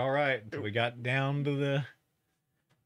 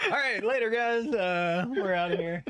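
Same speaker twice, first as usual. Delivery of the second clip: mock sign-off; 7 semitones higher than usual. Mock leaving the show.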